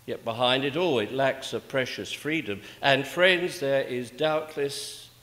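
usual speaking voice

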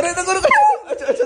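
high laughing rush of words. Real, not acted. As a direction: laugh as the dog leaps at you. Dog jumps for food. (हँसते हुए रोकना)

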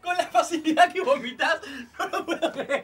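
high voice breaking up with laughter; laughing disbelief. Real, not acted. Friend watching someone retch easily, laughing disbelief. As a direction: squeal it through helpless laughter.

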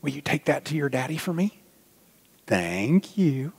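ordinary delivery

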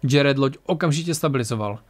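ordinary delivery, nothing marked

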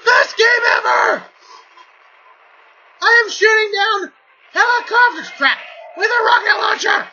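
ecstatic yelling. Real, not acted. Gamer thrilled about shooting helicopters, ecstatic shouting. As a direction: lose it with joy at the game.

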